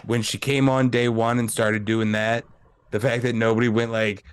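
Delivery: ordinary talk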